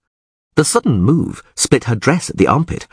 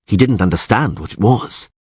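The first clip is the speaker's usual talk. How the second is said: ordinary delivery, nothing marked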